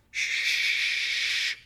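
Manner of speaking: whispered shh; hushing. A narrator's reading. Chef hushing the upset guest, whispered shh. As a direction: breathe a gentle shush. Finger to lips, hushing her.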